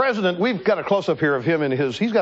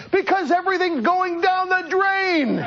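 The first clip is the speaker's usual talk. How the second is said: mock shout; 12 semitones higher than usual. Host imitating the female reporter's heckle, high mock shout through laughter. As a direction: play her heckle high and slow while giggling. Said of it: "And then she shouts".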